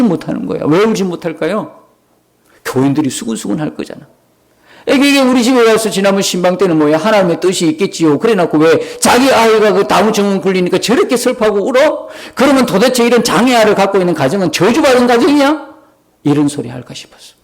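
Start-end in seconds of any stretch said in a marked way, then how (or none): 4.9-15.7 imitating accusing, indignant churchgoers, pitch climbing; reproachful gossip. Congregation gossips about the crying pastor's wife, imitated reproach. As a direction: build a mock indignant challenge toward the final question.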